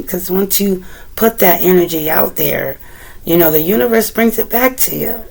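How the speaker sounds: ordinary talk, nothing marked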